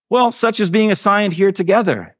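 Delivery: high, bright-eyed storybook reply; eager answer. A narrator's reading. Betsy eagerly answers her sister.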